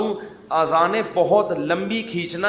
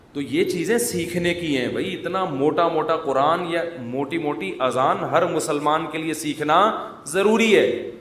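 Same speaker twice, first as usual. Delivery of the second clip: normal talking voice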